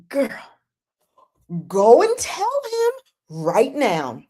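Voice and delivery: high, slow, drawn-out mock yell; exasperated mock scream; frustrated screaming. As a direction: scold the TV, stretching each word. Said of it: "I'm screaming at the T V".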